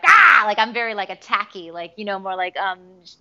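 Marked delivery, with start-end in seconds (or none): 0.0-0.4 loud, high attack cry; sudden fighting shout; aggressive yell. Retold or imitated. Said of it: "I'm like".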